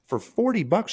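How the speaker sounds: high, indignant question; outraged buddy. Retold or imitated. Buddy telling the speaker what she asked, high and outraged. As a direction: squeal the disbelief.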